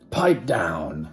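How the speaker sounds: stern command. Acted. Strict teacher silences class.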